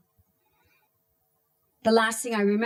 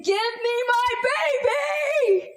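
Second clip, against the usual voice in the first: much higher, louder anguished scream; helpless scream; 14 semitones higher, 4 dB louder than usual. Retold or imitated. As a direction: scream for the stolen child. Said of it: "Helpless, I scream".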